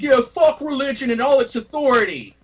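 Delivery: high sneering mock quote. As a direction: scoff like a smug rebel. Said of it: "and they're like".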